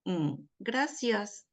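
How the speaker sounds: unwilling thank-you, stretched slowly and softly; reluctant thanks. Shown as a demonstration. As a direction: drag out a hesitant Mm, then a begrudging gracias. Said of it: "mi voz en sí, la pausa, la entonación, la fuerza".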